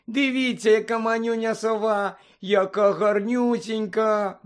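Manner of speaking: high squeal, last word stretched out; girlish cooing exclamation. A narrator's reading. Third-year girl spotting a tiny owl, squealing. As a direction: gush over something tiny and cute. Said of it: "одна з дівчаток вигукнула".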